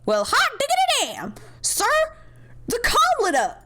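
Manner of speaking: high, whooping burst of excitement; excited exclamation. Acted. Underling alerts the boss that the comm lit up, excitedly. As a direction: call it out high, then drop at the end.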